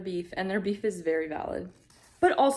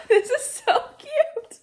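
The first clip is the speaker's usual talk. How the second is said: much higher, louder, slow; tearful squeal; 9 dB louder, slower than usual. Real, not acted. Reader melting over the reunion, squealing. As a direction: squeal through happy tears.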